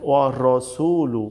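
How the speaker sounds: ওয়া রসুলু with a rounded "ro" and long su; correct heavy ra. Shown as a demonstration. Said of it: "এখানে হবে". (ওয়া রসুলু, মোটা র দিয়ে)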